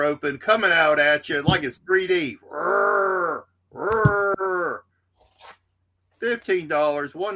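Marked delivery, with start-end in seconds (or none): 2.5-4.8 playful mock monster roar; beast roar. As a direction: roar like Sabretooth leaping off the cover. Voicing the Sabretooth cover.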